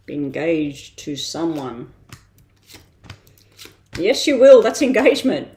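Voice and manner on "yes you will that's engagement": loud, high excited yes. Real, not acted. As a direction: burst out with delight. Excited card reading announcement.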